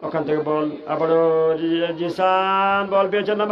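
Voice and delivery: rising excited commentary. Real, not acted. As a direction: start drawn out, then yell at the danger. Chance builds toward a shot.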